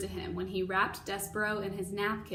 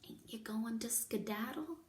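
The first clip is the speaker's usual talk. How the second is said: whisper; 7 dB quieter than usual. Acted. Mig whispers to the mouse.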